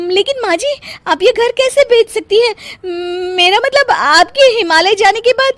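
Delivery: suddenly scared of losing the house; panicked. Acted. Daughter-in-law panics about house sale. (घबराकर ऊँची आवाज़ में)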